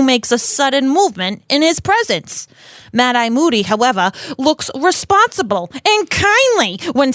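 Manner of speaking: mock New York columnist read; Rita Skeeter. Rita exposing Dumbledore's staff choices, New York accent. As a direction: lean on the juicy words in a New York accent. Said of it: "Rita has a New York accent".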